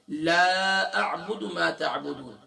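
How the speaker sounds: لا stretched long at the start; slow recitation with a long لا; drawn-out la. Shown as a demonstration. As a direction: hold the لا before اعبد. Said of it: "اگر آپ لا کو کھینچیں گے نہیں".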